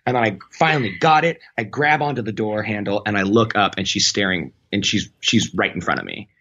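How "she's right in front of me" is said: ordinary delivery, nothing marked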